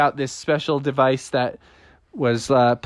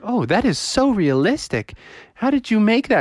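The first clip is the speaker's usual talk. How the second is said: higher, swooping amazement; impressed neighbors; 8 semitones higher than usual. Acted. Neighbors admire mommy's proton pack, impressed. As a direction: marvel at it with swooping pitch. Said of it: "And they're like".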